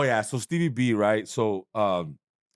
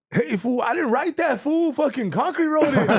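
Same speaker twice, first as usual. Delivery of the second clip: much higher, louder, joking buddy voice; playful imitation of Jay; 4 dB louder than usual. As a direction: toss the blame off like a laughing homie. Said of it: "Jay Valentino was like".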